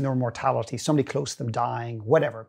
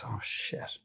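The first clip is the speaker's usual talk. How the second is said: muttered oh shit; 3 semitones lower, 12 dB quieter than usual. Retold or imitated. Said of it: "he caught himself and he just goes".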